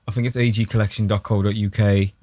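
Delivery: usual speaking voice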